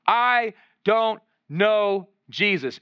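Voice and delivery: slow, weighty stress on each of "I don't know Jesus"; very emphatic. Acted. Peter's last denial of Jesus, "I don't know Jesus" slow and emphatic. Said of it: "he just says it very emphatically again".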